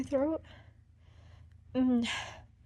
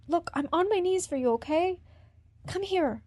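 ordinary talk

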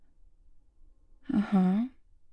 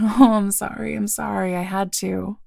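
laughing; 9 dB louder than usual. Real, not acted. Laughing after taking a sip.

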